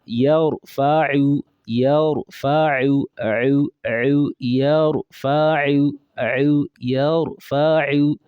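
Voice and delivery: Yarfa'u stretched, Ain stressed; slow teaching demo. Shown as a demonstration. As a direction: model Yarfa'u slowly and clearly. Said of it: "Repeat after me".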